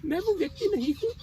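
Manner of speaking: high, distraught cry of despair; broken anguish. Acted. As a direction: pitch climbing as the character falls apart. Character confesses he is utterly broken.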